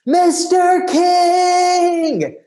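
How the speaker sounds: long, very high drawn-out shout; full-lung shout of Mister King; yelling at top of lungs. Retold or imitated. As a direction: call across the parking lot with everything. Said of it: "yelling at the top of my lungs".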